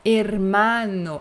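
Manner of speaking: Hermano slow with open e and o; drawn-out Hermano for learners; open Spanish hermano. Shown as a demonstration. Teacher modeling hermano slowly.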